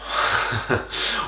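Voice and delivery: short breathy laugh of derision; scornful laugh. Acted. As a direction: laugh dismissively at the very thought. Scoffing at cheerful musicals in monologue.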